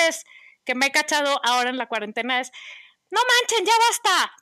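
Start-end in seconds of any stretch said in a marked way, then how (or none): 3.1-4.3 high, loud scolding mom voice; scolding shout. Retold or imitated. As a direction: yell at the kids to stop the screens. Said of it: "antes de dar ese grito". (regaño a gritos de mamá)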